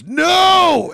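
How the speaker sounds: high, long, loud yell; imitated alarmed shout. Boyfriend stopping her throwing a bottle, imitated shout.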